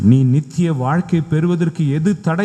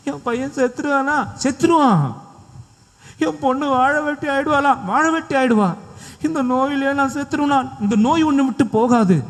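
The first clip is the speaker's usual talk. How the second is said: worried mother; 8 semitones higher than usual. Retold or imitated. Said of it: "ஒரு அம்மா கிட்ட கவுன்சிலிங்ல".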